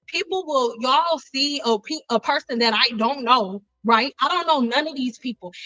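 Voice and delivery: ordinary talk, nothing marked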